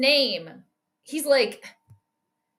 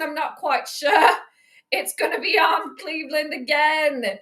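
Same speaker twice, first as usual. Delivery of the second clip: normal talking voice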